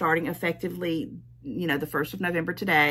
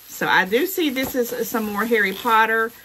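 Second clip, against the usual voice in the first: ordinary delivery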